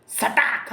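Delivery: high, cartoon whip-crack noise; voiced whack effect. Narrator voices the blow on the king, high cartoon whack. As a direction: snap out a comic sound effect. Imitated whack sound of the comic.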